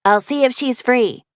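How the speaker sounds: high chirpy pretend voice; high fake voice. A narrator's reading. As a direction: keep the pretend pitch up. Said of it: "the Kelsey voice says".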